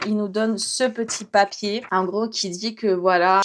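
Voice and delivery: ordinary talk, nothing marked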